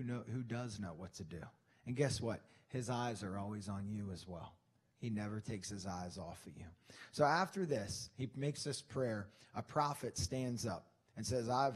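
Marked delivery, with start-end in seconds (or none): none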